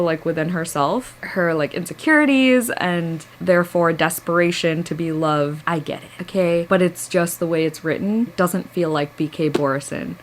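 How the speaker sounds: ordinary talk, nothing marked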